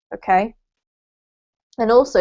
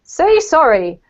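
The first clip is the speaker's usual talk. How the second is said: put-on telling a child; 5 semitones higher, 4 dB louder, slower than usual. Shown as a demonstration. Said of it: "If you're talking to children".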